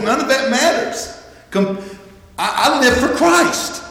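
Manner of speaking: high-pitched, impassioned insistence; soaring, emphatic testimony of faith; fervent declaration. Acted. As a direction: lift the voice high and declare it with conviction. Paul's dismissal repeated as fervent declaration.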